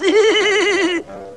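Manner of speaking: very high henchman's giggle; dopey giggle. Acted. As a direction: giggle high like a simple sidekick. Henchman laughing about his boss.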